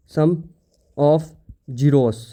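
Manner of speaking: each word apart with long pauses. Shown as a demonstration. Teacher dictating a term to students, slow and spaced. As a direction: spell it out slowly for the class. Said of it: "sum of zeros find करेंगे".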